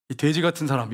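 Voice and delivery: normal talking voice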